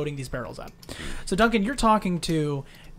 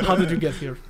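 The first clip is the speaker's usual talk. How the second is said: put-on Russian accent. Game master voices Adrian questioning the travelers, Russian accent. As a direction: switch deliberately into a Russian accent. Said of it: "Uh, Russian. Russian".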